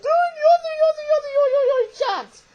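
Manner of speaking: very high, loud squealing impression; exaggerated high-pitched mimicry of fed-up people; mock complainers. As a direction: whine it in a shrieky put-on voice. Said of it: "I have people going".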